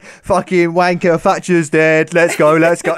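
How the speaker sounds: slow loud laddish yell; rowdy football fan. Retold or imitated. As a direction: drunk British fan chanting. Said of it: "an Arsenal shirt, like, holding a Stella".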